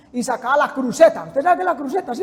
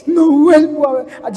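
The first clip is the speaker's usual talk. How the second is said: hammed-up drunken vow; repentant drunk; 6 dB louder than usual. Little drunk swearing off alcohol, mock remorse. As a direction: play a wailing, remorseful drunk. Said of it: "el borrachito".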